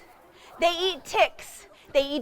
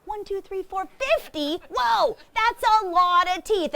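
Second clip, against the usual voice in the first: mock counting; 6 semitones higher than usual. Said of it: "she wants you to go".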